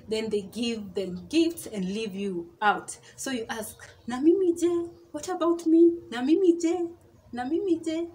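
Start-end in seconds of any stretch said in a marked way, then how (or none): 4.1-8.1 high, loud protesting question; left-out protest. Acted. Left out of gifts by friends, asking in loud high protest. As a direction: speak up, pitched high, as the one left out.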